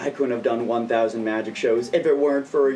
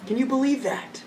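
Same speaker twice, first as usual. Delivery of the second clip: pet talk; 12 semitones higher than usual. Real, not acted. Asking pet Finn.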